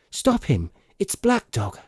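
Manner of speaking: high urgent call to stop a man; urgent cry. Acted. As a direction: shout the name in alarm. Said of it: "I cried".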